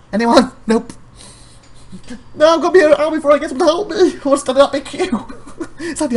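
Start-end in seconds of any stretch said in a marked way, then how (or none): 2.3-4.0 fast, loud, high rant; exasperated rant. Real, not acted. Vlogger ranting about no staff, fast and loud. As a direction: rush out the frustration.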